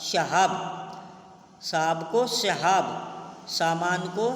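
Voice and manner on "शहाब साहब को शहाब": शहाब with a sh sound in place of s; wrong s sound. Shown as a demonstration. Teacher showing students the Bengali-style sh for s. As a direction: say शहाब as sha-haab, a bit slowly. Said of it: "सबको कहते हैं".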